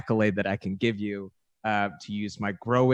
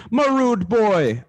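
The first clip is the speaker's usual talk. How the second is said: slow, drawn-out, higher shout; 10 semitones higher, 6 dB louder, much slower than usual. Shown as a demonstration. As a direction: belt out the name. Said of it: "'cause I get to shout".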